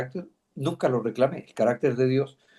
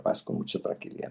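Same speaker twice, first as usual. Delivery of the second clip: soft whisper; 10 dB quieter than usual. Real, not acted. Peaceful close of testimony.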